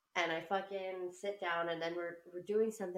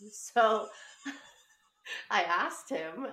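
laughing; 2 semitones higher, 6 dB louder, much slower than usual. Real, not acted. Laughing while telling story.

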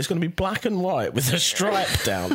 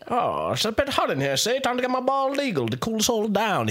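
1930s gangster; 7 semitones higher than usual. Pretend. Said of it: "I'm also from the nineteen thirties".

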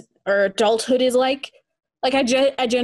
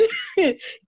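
wild rambling cackles; 9 semitones higher, 3 dB quieter than usual. Pretend. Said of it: "the old witch of the mountains".

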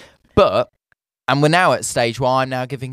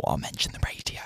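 whispering; 9 semitones lower, 10 dB quieter than usual. Retold or imitated. Retold private thought, hushed.

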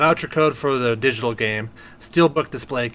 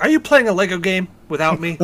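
imitating daughter; 7 semitones higher, 6 dB louder than usual. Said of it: "she was just like".

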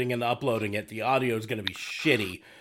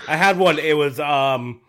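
imitating co-host; 2 semitones higher, 7 dB louder than usual. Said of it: "he's like".

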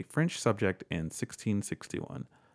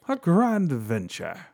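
high, slow, hammed-up British accent; mock British; 6 semitones higher, 4 dB louder, slower than usual. Host jokes in an old royal voice, hammed-up British accent. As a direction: put on a posh British accent as a joke. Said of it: "That's British".

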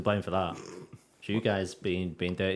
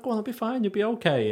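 high mock reassurance; 13 semitones higher, 3 dB louder than usual. Teasing reassurance, quoted self.

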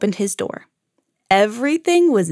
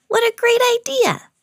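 little bird voice; 14 semitones higher than usual. Acted. Bird voice delighted.

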